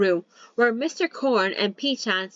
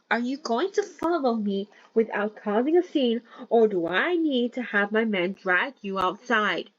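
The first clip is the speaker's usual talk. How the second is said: plain reading voice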